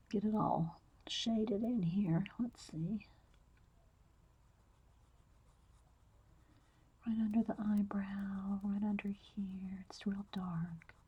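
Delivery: soft whisper, close to the mic. Real, not acted. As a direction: whisper as you draw. Whispers while drawing.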